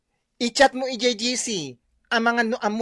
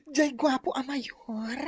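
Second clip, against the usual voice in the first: usual speaking voice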